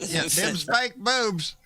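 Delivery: high, slow hammed-up Southern accent. Host rehearses the line for Robin, high slow Southern accent. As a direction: squeal it out in an old Southern lady accent. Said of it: "in your best Southern accent".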